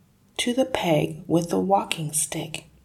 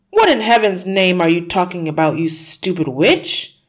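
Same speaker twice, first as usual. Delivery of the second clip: furious shout; 2 semitones higher, 8 dB louder than usual. Acted. Husband shouting insults at his wife. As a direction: spit out stupid witch. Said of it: "Mister Wormwood shouted".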